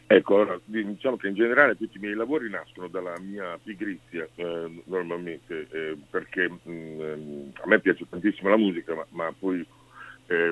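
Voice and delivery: faint, far-off phone voice; talking on speakerphone, away from mic. Real, not acted. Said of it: "ma sta in viva voce?".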